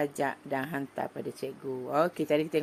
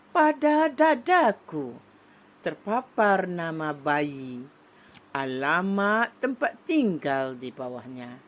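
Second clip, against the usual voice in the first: declaimed poem. Shown as a demonstration. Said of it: "deklamasikan lagi sekali ke".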